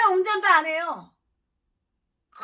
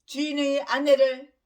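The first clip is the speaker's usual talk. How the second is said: preaching; 2 semitones higher than usual. Acted. Long sermon passage.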